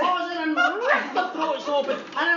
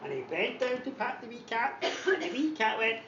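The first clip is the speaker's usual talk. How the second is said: ordinary talk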